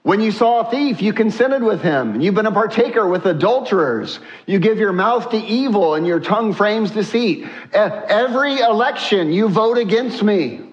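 high-pitched, driving scriptural indictment; impassioned preaching. Acted. God rebuking the wicked for their choices, forceful preaching.

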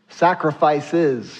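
Sacrifices slowly, the final S drawn out; preacher hammering that Sacrifices is plural; stress on sacrifices. Shown as a demonstration. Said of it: "circle the S".

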